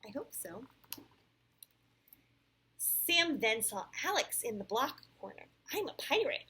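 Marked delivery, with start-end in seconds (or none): 2.8-5.5 clear, animated read-aloud; storybook voice with lively rises and falls; picture-book narration. A narrator's reading. Parent reads Sam's story to a child, animated storytelling. 5.7-6.4 higher, boastful little pirate; bragging. A narrator's reading. Alex boasts of his pirate ship to Sam, bragging. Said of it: "Alex bragged".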